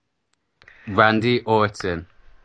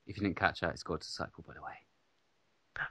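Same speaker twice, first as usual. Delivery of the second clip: quiet aside; 6 semitones lower, 19 dB quieter than usual. Acted. Quiet aside after announcing.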